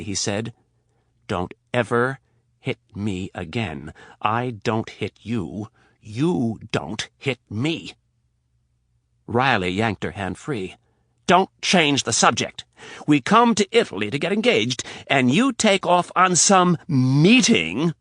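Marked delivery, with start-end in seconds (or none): 1.3-7.9 slow and deliberate. Acted. Rapp warning Riley inches from her face, slow and deliberate. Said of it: "Very slowly, clearly, and deliberately". 11.3-17.9 high, loud complaint; angry accusation. Acted. Riley accusing Rapp about the trip, loud.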